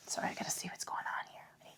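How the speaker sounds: soft unvoiced whisper to herself; whispered aside. Real, not acted. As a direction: mutter it under your breath, whispering. Aside while checking an itch.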